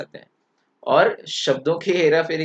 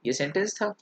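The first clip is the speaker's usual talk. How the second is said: ordinary delivery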